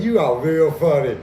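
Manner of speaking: loud, steady warning line; menacing inmate. Retold or imitated. The inmate warning Fleece he is real funny, loud menace. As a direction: say it as a cold threat.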